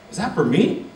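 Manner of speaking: wide-eyed boy voice, mock-childlike; amazed little boy. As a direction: squeal it like a kid getting a gift. Boy amazed by new football.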